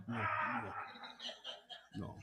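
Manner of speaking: ordinary talk, nothing marked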